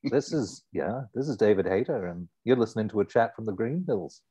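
put-on Liverpool accent, slightly lifted. Shown as a demonstration. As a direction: introduce the show in Scouse. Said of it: "your Liverpool accent".

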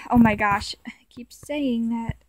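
whispered aside to herself; a soft unvoiced oh my gosh; embarrassed whisper. Real, not acted. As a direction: whisper it under your breath, embarrassed.

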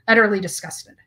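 usual speaking voice

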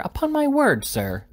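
very high swoop, dropping on "sir"; affronted wife, pitched sky-high then falling; astonished, menacing. A narrator's reading. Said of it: "the tone of voice in which it was delivered".

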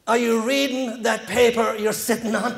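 slow, expressionless stranger's question; deadpan. Retold or imitated. Irish stranger to man on barstool, slow deadpan. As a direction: ask it straight-faced, drawing it out. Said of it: "like a deadpan face".

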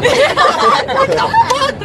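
high, slow, laughing what the fuck; laughing disbelief. Real, not acted. Friend cracking up over the prank sketchbook, laughing.

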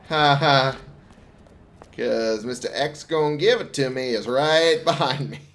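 ordinary delivery

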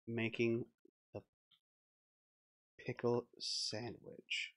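soft, slow narrator voice; quiet narration. A narrator's reading. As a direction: read it slowly and softly.

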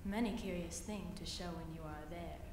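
low, soft coaxing spider voice; sly spider. Acted. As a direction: purr it low and sweet like a spider. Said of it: "said the spider to the fly".

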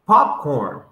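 ordinary talk

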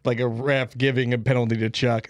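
normal talking voice

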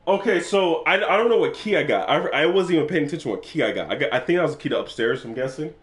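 ordinary delivery

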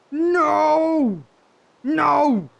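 long, high, loud cry of dismay; anguished shout. Real, not acted. Player finding the game still broken, anguished shout. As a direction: wail it out at full voice. Glitch did not reset.

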